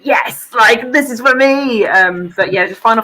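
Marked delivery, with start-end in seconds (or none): 0.0-1.8 higher, louder delighted outburst; mock cheer of finally being won over; retold excitement. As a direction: act out your own delight, raised voice. Retelling own excited reaction to game.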